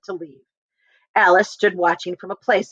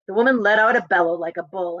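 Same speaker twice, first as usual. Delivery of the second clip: usual speaking voice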